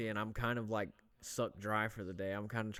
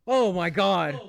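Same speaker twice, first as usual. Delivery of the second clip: much louder and higher startled cry; real loud outburst; 8 semitones higher, 14 dB louder, much slower than usual. Real, not acted. Host startled by a loud noise, cries out loud. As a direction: blurt it out loud. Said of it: "That was loud as fuck".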